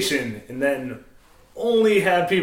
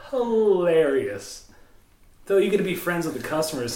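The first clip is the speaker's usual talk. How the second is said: ordinary talk, nothing marked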